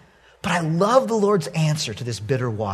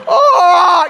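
loud, high drawn-out cry; mock howl of outrage; exasperated yell; 12 semitones higher, 9 dB louder than usual. Owner licked in the ear again, exasperated yell.

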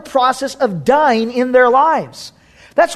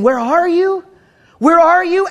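calling out; 5 semitones higher than usual. Acted. God calling for Adam.

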